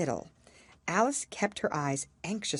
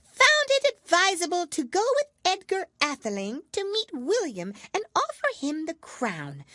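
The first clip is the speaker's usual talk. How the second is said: self-important mouse; 12 semitones higher than usual. A narrator's reading. Mouse recites dry history to dry everyone, pompous. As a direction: lecture like a tiny schoolmaster. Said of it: "hurriedly went on".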